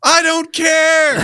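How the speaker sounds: mock Tommy Lee Jones, dragged slow and loud. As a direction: stretch it out like a far-off marshal. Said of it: "He's like".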